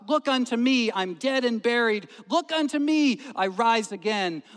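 high, loud, repeated exhortation; impassioned lay preacher. Retold or imitated. Lay preacher speaking as Christ to sinners, impassioned call. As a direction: cry out the text with rising fervour. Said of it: "the good man followed up his text in this way".

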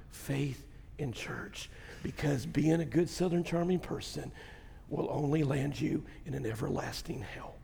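very soft, low pulpit hush; quiet, low, solemn sermon delivery; hushed grave preaching. Real, not acted. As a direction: drop almost to a whisper to warn them.

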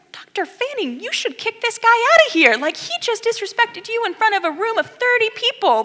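high, loud indignant urging; high-pitched outraged appeal; indignant plea. Retold or imitated. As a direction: demand he throw the kid out. Indignant student urging expulsion.

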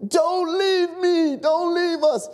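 high, drawn-out begging cry; desperate plea. Acted. Trapped fireman to his rescuer, desperate plea. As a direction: beg a rescuer with all your might.

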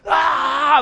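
loud, high mock crowd cheer; crowd roar. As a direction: imitate the whole arena yelling back.

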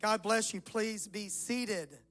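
ordinary delivery

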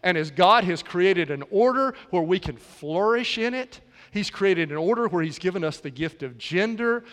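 ordinary delivery

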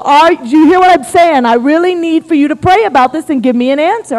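urgent insistence. Retold or imitated. Husband begging wife to pray and confirm, urgent insistence.

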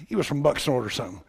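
usual speaking voice

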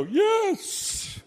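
high, drawn-out mock-eager squeal; comic gullible yes. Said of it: "I'm trying to say it funny".

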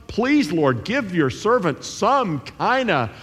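high, half-joking plea to God; mock-begging prayer, pitched high; pleading listener. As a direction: wheedle the Lord for a bone. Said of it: "you're hoping for some".

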